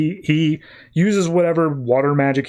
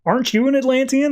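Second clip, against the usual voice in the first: puzzled question; 10 semitones higher than usual. Retold or imitated. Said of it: "fishermen who are like".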